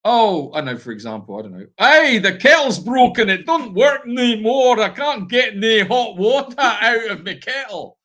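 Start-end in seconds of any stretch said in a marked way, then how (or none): 1.8-8.0 high, loud mock Scottish wife complaining; imitating a Scottish wife whose kettle broke. Imitating his wife's Scottish complaint about the kettle. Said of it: "she'll say".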